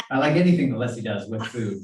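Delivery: laughing through the line. Real, not acted. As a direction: chuckle while talking. Laughing about friend's cooking.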